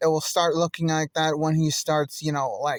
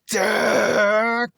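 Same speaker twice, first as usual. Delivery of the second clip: drawn-out rough shout of a name; 4 semitones higher, 4 dB louder than usual. Acted. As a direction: yell DEKU long and harsh, not high. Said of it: "Shouted the".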